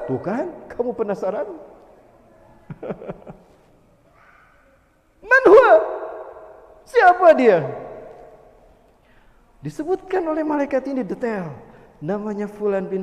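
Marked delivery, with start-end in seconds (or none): 5.3-7.7 loud, high rhetorical call; dramatic question. Acted. Preacher to the congregation, loud rhetorical question. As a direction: fling the question out to the hall.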